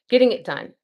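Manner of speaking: energetic self pep talk. Real, not acted. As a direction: pump yourself up. Hyping herself up.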